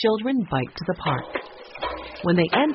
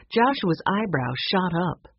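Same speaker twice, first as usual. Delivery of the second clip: usual speaking voice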